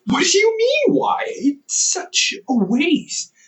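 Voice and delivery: high, loud, drawn-out protest; indignant disbelief. Real, not acted. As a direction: act shocked anyone would ask why. Defends not throwing food out.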